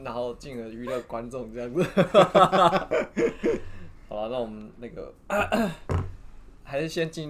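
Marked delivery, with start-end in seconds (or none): 1.9-4.0 laughing. Real, not acted. Laughing at own joke.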